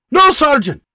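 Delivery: high, loud private at attention; snapped reply. Acted. Private snapping to attention for his sergeant. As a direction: snap it out at attention.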